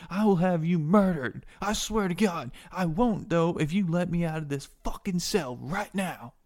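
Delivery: high, loud, outraged rant; furious prisoner. Acted. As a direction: rage at your jailer, threatening and pleading. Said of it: "He's like".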